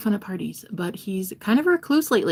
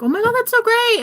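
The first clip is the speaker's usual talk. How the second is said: high, loud mock gushing; loud, high-pitched imitation of fawning; gushing praise; 9 dB louder than usual. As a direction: squeal it like an over-impressed fan. Mimics gushing classmates' praise.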